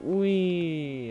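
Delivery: drawn-out gleeful whee; playful whee. Real, not acted. Player drives over a bump in game, gleeful. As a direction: ride the jump with a whee.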